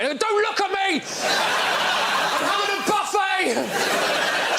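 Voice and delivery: mock defensive yell. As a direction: squeal it through a mouthful. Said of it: "Just wedge my face into it".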